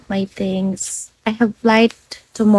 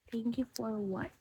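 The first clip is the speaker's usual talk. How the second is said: repeated under the breath, much softer; softly repeating a viewer's puzzling thanks; near-silent; 2 semitones lower, 20 dB quieter than usual. Real, not acted. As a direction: murmur the question to yourself. Streamer repeats the question softly.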